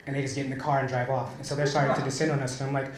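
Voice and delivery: ordinary delivery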